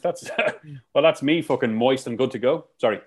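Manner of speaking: ordinary talk, nothing marked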